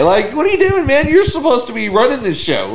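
high-pitched, incredulous heckling. Retold or imitated. Viewer taunting the chef who got challenged, incredulous. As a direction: egg him on in disbelief, pitch up. Acted reaction to chef's confrontation.

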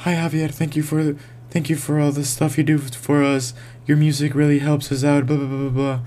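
high-pitched eager fan letter. Retold or imitated. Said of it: "John from Utah says".

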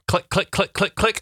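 rising playful clicks; imitating knob clicks. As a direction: mime clicking the knobs. Miming checking stove knobs.

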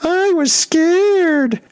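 very high, very slow, mocking squeak; squeaky drawn-out brother's taunt; high-voiced mockery. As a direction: tease like a big brother mocking. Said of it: "high-voiced".

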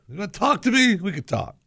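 imitating a challenge, pitched high; mock confrontational, voice leaping up then dropping off; spoiling for a fight. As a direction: say it like you're inviting a fight. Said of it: "the inflection is, you know, th-, we're gonna fight".

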